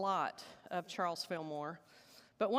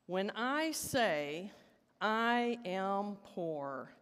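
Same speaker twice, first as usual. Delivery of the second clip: slow, deliberate call, words stretched out; slow call and response; much slower than usual. Shown as a demonstration. Leading the congregation in call and response, slow and drawn out. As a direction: lead it slowly so the room can echo back. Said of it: "do this as a call and response".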